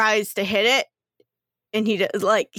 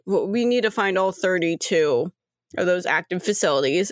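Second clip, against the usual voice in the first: ordinary talk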